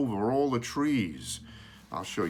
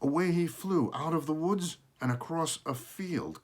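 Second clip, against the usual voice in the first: usual speaking voice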